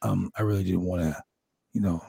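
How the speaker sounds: low, earnest, halting impression; low-voiced wrestler confiding; Jake the Snake Roberts. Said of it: "he's like".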